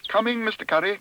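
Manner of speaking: high-pitched eager call; crying out. A narrator's reading. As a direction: answer with a high cry. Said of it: "cried Paddington".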